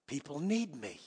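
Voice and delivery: low, very soft mock protest; self-important plea. Preacher recalls arguing with the Holy Ghost, low soft mock plea. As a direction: murmur it low, pleading your importance.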